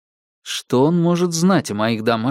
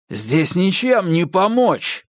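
slow, higher angry growl; 6 semitones higher, slower than usual. A narrator's reading. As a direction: growl it out, fed up. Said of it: "прорычал я". (прорычать сквозь злость)

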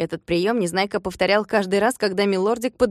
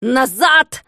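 loud, higher stopping command; shouting; 4 semitones higher, 6 dB louder than usual. A narrator's reading. Klops calling the workers back, shouting. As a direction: bark them back. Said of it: "закричал он".

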